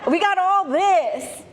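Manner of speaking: Adam and Eve brushing off God's tree, slangy and high. Retold or imitated. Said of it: "they're like".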